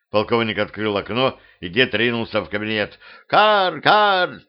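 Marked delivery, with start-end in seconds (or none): 3.3-4.4 high cawing; crow caw. Acted. Crow caws.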